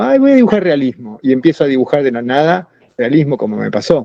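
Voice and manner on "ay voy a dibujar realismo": imitating a sudden urge, a touch excited; sudden inspiration. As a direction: pitch jumps up on a light, mock eureka. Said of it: "yo me puedo despertar y digo".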